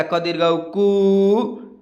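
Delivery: final "कु" held as a long oo; long vowel. Shown as a demonstration. Teacher spelling out the long oo sign, final "कु" drawn out. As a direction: stretch out the oo in "कु". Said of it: "इनको खींच रहा हूँ".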